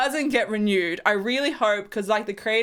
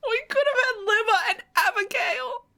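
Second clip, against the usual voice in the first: much higher, tearful wail; emotional; 11 semitones higher than usual. Real, not acted. Fan lamenting her lost ship, high and tearful. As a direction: pine for what was lost, close to crying. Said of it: "I'm so emotional".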